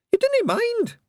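very high, eager Scots pixie; hopeful Pixie. A narrator's reading. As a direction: ask it hopefully as a wee Feegle.

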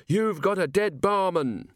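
high, slow menacing threat; threatening robber. A narrator's reading. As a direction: drag out the threat.

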